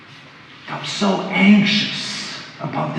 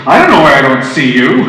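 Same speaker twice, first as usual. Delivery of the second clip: louder, pushy, on the defence; defensive, accusing; 3 semitones lower, 8 dB louder than usual. Retold or imitated. Telling a coworker why I missed her, in a louder, accusing tone. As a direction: throw it back at her as a charge. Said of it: "it wasn't".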